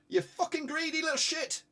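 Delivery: annoyed fan swearing; exasperated curse. Real, not acted. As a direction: vent at a greedy player. Player didn't pass the ball.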